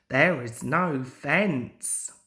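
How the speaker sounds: plain reading voice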